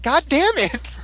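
hammed-up exasperated girl; mock girl voice. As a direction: squeak it like the girl would. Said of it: "She's like".

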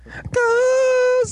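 very high, drawn-out chant; mock battle cry. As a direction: stretch it out like a theme-song cry. Imitates show's theme cry.

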